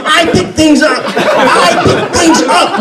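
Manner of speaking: laughing mantra. Retold or imitated. Hauled out by the bouncer, laughing the phrase slowly. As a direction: stretch the mantra out through laughter. Repeating phrase while dragged out.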